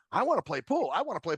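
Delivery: mock pestering, pitched high on the first plea. Friend's wife bugging the group to play pool, pestering. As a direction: nag like someone begging for a game. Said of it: "she just kept bugging".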